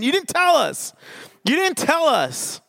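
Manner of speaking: high-pitched comic complaint; mock-aggrieved whine of disbelief; exasperated protest. Acted protest back at the guide.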